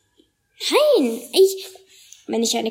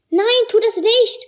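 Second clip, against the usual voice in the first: much higher, loud warning shout; 10 semitones higher, 5 dB louder than usual. A narrator's reading. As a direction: yell to stop him right now. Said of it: "höre ich Chelo rufen".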